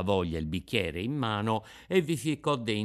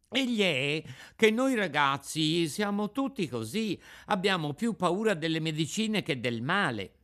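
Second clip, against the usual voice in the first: put-on higher boyish voice, explaining; Pinocchio, sheepish puppet child; 7 semitones higher than usual. Puppet explaining boys' fear of medicine, boyish excuse.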